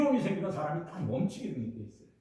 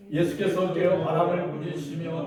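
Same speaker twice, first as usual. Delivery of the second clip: scripture reading; 4 dB louder than usual. Acted. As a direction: intone the verses like a chant. Reads scripture aloud.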